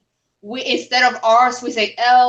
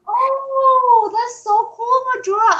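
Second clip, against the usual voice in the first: very high, drawn-out excited "oh"; delighted exclamation; 13 semitones higher, slower than usual. Real, not acted. Friend reacts to talk of the wall, delighted. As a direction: gush with sudden delight.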